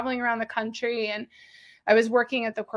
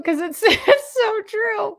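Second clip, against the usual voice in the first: laughing through the words, slow and high; 11 semitones higher, 4 dB louder, slower than usual. Real, not acted. Laughing with her cohost about the shirt joke. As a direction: giggle it out.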